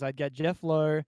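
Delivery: ordinary talk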